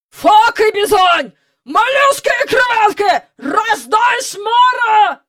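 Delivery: loud, high-pitched seafaring bellow; thundering admiral. A narrator's reading. Admiral dodging a great oak, thundering sea oaths. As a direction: thunder it out like a ship's commander. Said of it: "гримів адмірал".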